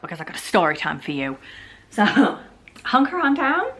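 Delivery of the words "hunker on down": ordinary talk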